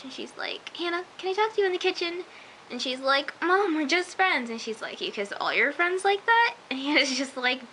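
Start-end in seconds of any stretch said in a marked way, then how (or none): 0.8-2.2 mock mom voice, higher and quick; confronting mom. Mom to daughter caught making out, imitated confrontation. 3.4-4.5 put-on higher, drawn-out teen protest; imitated protest. Caught daughter excusing herself to mom, mock protest. 5.0-6.5 imitated challenge, pitched up high; incredulous mom. Mom challenging daughter about kissing, mock incredulous.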